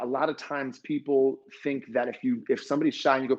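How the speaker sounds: ordinary delivery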